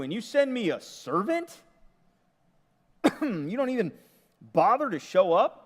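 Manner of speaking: high, loud, indignant rant; offended commander. Retold or imitated. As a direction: bristle like an insulted general. Offended commander Naaman voiced.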